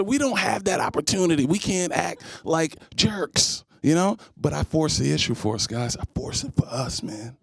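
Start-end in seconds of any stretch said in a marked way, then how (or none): 6.0-7.4 soft, breathy, much quieter; whispered aside. Real, not acted. Comic confiding to the men, whispering. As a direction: lean in and whisper it.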